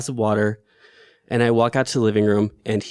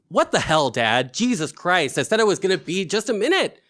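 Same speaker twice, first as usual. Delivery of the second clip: retold angry outburst; 7 semitones higher than usual. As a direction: relive the angry snap at her father without shouting. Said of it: "my reaction that comes out of me is just pure anger".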